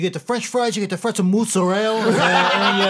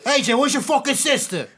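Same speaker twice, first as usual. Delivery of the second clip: mock Tony Soprano; 8 semitones higher than usual.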